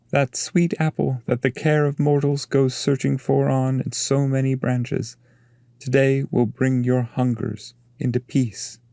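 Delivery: a master announcing the prize; Virgil's voice. Acted.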